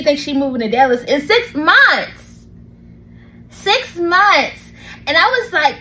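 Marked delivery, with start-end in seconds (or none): 1.5-2.5 MONTHS punched high and loud; incredulous stress. Real, not acted. Sister stunned by the moving news, stressing MONTHS. 3.5-4.6 SIX MONTHS spaced out and high; incredulous repeat. Real, not acted. Sister stunned by the moving news, incredulous stress.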